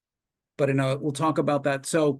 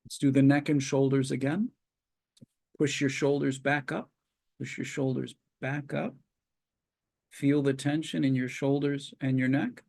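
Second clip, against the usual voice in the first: calm, measured relaxation guide; walk them through tensing and releasing, unhurried; guided relaxation. Acted. Leads progressive muscle relaxation.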